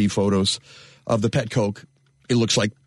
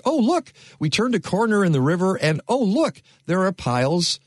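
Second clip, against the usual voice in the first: high, wide-eyed discovery voice; mock surprise; 7 semitones higher than usual. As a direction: act out a surprised find. Host mimics surprised discovery.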